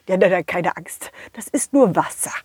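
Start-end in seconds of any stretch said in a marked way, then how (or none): none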